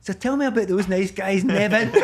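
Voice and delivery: high, loud teasing jibe while laughing; mocking through laughter. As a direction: laugh the sarcastic question at him.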